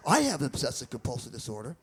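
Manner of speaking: low, mock-earnest fan voice; lightly voiced earnest fan. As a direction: play the fan, dropped low and deadpan. Fan telling comedian after show.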